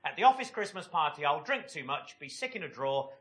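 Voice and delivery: ordinary talk, nothing marked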